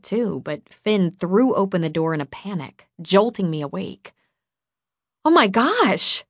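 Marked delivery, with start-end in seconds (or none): 5.2-6.2 high, loud cry of alarm; startled shout. A narrator's reading. Girl jolted awake on the couch, startled shout. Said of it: "I shouted".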